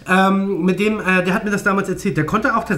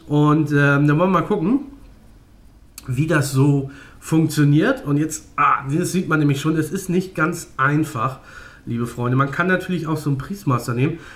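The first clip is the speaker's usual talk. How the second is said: normal talking voice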